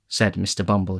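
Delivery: usual speaking voice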